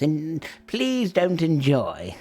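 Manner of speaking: slow, sugary sign-off; mock sweet hostess. [name] dismissing the guests with a slow sly wish. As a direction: draw it out with sly menace.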